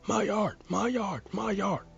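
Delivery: high, loud repeated yell. A narrator's reading. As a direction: yell it over and over, high-pitched.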